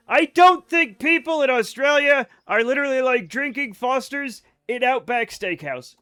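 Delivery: worked-up loud protest; emphatic rant. Real, not acted. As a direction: argue it loudly to the chat. Ranting at chat about Fosters.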